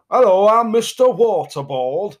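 drawn-out comic announcer voice; Mister Waterboard voice. Pretend. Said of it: "I assume he talks like that".

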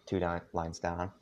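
usual speaking voice

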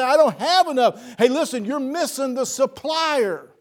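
high, loud, fervent preacher's climax; preaching at full force. Acted. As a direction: lift the congregation with rising fervor. Peak of sermon, fervent appeal.

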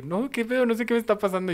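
high-pitched, mildly whiny retelling; mock tearful bewilderment. Talking to his wife while crying, lightly mock tearful. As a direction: lightly imitate a baffled crier. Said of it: "Y estaba lloré y lloré".

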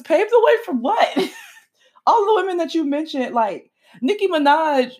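high, giggling disbelief; laughing. Real, not acted. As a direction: laugh through it, amazed at the post. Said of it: "but I'm laughing".

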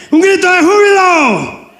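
high rallying call building to a long shout; jubilant shout. Real, not acted. Preacher wants congregation heard in Quebec, building to high shout. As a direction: send it out to the far towns. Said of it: "los gritos de ustedes se escuchen".

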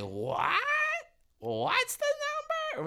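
high, drawn-out mock drunk girl; drunk woman's squawk. Retold drunk girl at booth.

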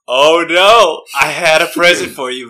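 loud, hammed-up oh-no act; feigned dismay. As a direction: badly fake distress over a lost present. Feigns losing gift, overacted.

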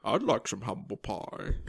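low, slow masked villain; Bane. Acted. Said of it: "What are you doing here, Bane?".

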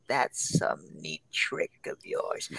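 slow, low, hissed villain line; menacing Goblin whisper. Retold or imitated. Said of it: "he's just like".

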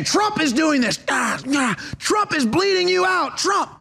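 high, hammed-up shouting attacker; drawn-out mock shriek of accusation; mock frenzied accuser. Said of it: "And they're saying".

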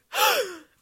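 loud, very high shocked gasp; hammed-up shocked gasp; huge dramatic gasp. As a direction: gasp like the world just ended. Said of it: "And I was like".

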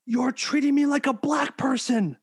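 high-pitched mock yell of a man on the ground. Retelling a viral arrest video, high mock yell. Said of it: "yelling and screaming".

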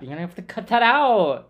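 urgent high-pitched plea; loud alarmed insistence; panicked loud. Real, not acted. Panicked about recording.

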